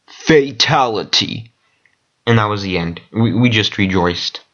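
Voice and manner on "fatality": slow, drawn-out fighting game announcer. Retold or imitated. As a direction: stretch it like a fighting game finisher call. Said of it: "it's like".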